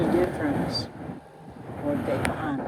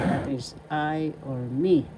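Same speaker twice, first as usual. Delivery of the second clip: ordinary talk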